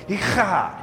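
Echad with a phlegmy, scraped CH; throaty CH. Shown as a demonstration. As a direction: clear your throat on the CH of Echad. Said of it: "trying to get that phlegm out of your throat".

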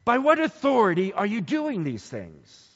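an accusing interrogation, acted out; pouncing challenge. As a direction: challenge them, ready to pounce. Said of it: "they're ready to pounce".

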